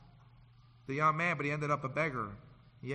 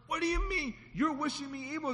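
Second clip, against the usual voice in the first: higher, offended questions; indignant son; 9 semitones higher than usual. Retold or imitated. Son outraged at father's prayer, lightly voiced. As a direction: protest like a stung son. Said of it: "He started cursing".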